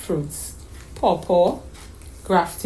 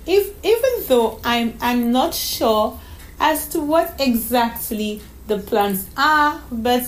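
put-on high, halting child's voice; young child bubbling over with a secret; excited little girl; 4 semitones higher than usual. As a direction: stammer eagerly like a little girl. Voices young Donna May in story.

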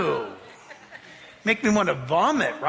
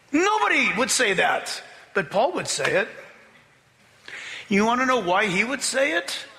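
usual speaking voice

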